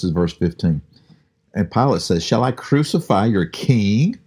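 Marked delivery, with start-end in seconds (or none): 2.2-4.2 slow, higher, mocking question; snarky. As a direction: sneer it as a taunt. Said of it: "in sort of a snarky way".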